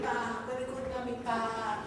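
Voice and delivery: put-on soft little bleating kid goat, a touch of a ba; tiny goat asking its mammy, hushed; quiet bleating kid goat voice; baby goat voice. Little goat speaking in a joke.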